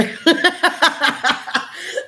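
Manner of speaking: sudden high cackle at her own joke; high burst of laughter. Real, not acted.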